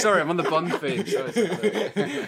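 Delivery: laughing. Real, not acted. Laughs at own name mix-up.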